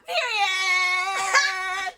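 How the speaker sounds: high, drawn-out sassy cry; playful loud squeal. Pretend. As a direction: belt it out high and long.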